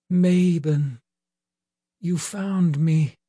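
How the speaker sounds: a hint of a weak fairy lady; frail lady voice. A narrator's reading. Weak wounded lady speaks.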